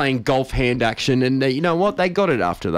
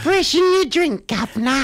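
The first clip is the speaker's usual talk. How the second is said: high, slow put-on servant voice; slow, high mock-posh "governor"; mock butler; 14 semitones higher than usual. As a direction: play a hammed-up butler topping up a glass. Mock butler offering a drink.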